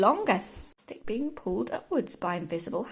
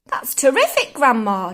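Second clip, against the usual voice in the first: excited cry; 10 dB louder than usual. A narrator's reading. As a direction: exclaim it in delighted amazement. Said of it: "George cried".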